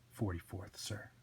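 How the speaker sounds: low, very soft correction; understated, obedient sidekick; meek deputy Twitch. Acted. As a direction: correct the boss quietly.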